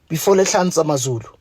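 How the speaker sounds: ordinary talk, nothing marked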